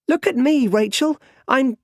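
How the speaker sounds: put-on high, loud boyish call; excited little boy. A narrator's reading. Boy calling to his friend from the well, excited and high. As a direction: play a small boy showing off, high-pitched and loud. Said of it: "climbs onto the well and laughs".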